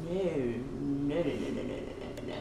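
no after no, dropping to a breath; fading nos. Real, not acted. Refusing the guy, nos trailing off into a whisper.

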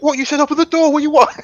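mock indignant. Jack mocks the complaint.

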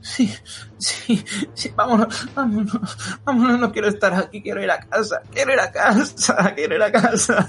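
put-on high, frantic child begging to leave; terrified pleading. [name] as Regina begging Michelle to take her home, high and frightened.

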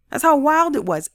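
ordinary talk

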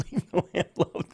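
giggling through "Leave the lamp, love"; laughing. Real, not acted. Host laughing at his own slip to Thomas, giggling.

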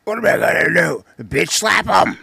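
high mock Jake Roberts impression; imitating Jake Roberts. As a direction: ham up the wrestler's threat. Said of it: "Jake can still cut a promo".

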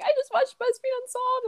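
high-pitched mock-weepy voice; mock crying. Teasing friend over tearful Snapchats, mock crying. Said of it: "Snapchat me crying".

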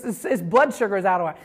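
ordinary talk, nothing marked

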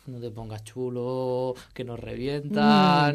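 slow, drawn-out caution; slow warning. Retold or imitated. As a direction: warn him slowly, each word stretched. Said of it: "Y yo en plan".

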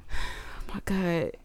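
slow, breathless whisper of dread; panicked whisper. Retold or imitated. Panicking, staring at the TV.

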